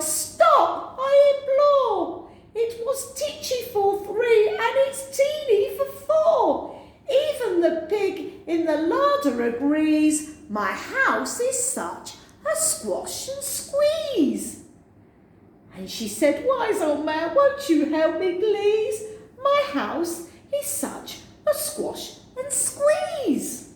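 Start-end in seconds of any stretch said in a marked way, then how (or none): 0.0-14.6 crying out. Acted. Old woman crying out about her crowded house, high and wailing. 16.4-23.7 beg, stretching the words; pleading old woman. Acted. Refrain plea.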